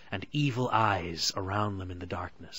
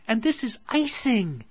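high, loud delighted cry; excited exclamation; 14 semitones higher, 6 dB louder than usual. A narrator's reading. Gretel tasting the icing, thrilled and loud. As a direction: gasp it out like a thrilled little girl. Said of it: "exclaimed Gretel".

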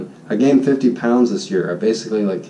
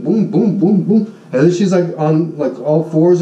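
ordinary talk, nothing marked

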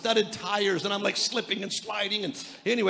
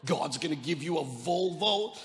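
sarcastic disbelief; 7 semitones lower, 6 dB quieter than usual. Retold or imitated. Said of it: "I'm like".

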